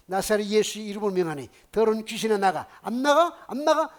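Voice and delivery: loud, high-pitched exorcist's shout; forceful preacher casting out a demon; shouted exorcism command. Retold or imitated. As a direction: order the demon out at full volume, building to a yell. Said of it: "막 소리 지르잖아요".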